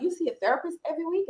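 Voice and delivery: high, fast disbelieving question; surprised question. Retold or imitated. Asking a friend in surprise, high and fast. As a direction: blurt it out in disbelief. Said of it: "I'm like".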